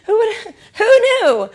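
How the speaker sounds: high, loud laughing cry; giggly disbelieving shriek; laughing squeal. Real, not acted.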